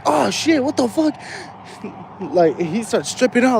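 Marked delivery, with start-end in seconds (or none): 0.1-1.1 mock pained yelp, much higher; imitating a hurt kid's cry; hammed-up ouch, voice jumping up; pained outburst. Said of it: "He's like".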